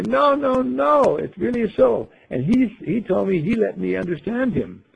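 high, loud protesting no; insisting protest. Retold or imitated. Ingeborg insisting to the gang the bird spoke, high and loud. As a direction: insist hard it's true. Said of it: "I'll say".

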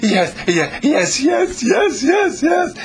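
eager yeses. Retold or imitated. Retelling the lady on the phone, rising to a shout. Woman eagerly agreeing, retold.

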